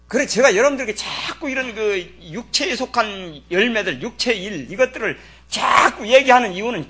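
loud, high, forceful sermon; fiery preaching. Acted. Pastor rebuking the congregation, loud fiery preaching. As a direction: thunder the warning at the congregation.

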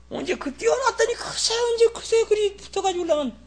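very high falsetto grumble; hammed-up high-pitched fussing; mock exasperation. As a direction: squeal the complaint in a put-on high voice. Mock complaint about people drifting together.

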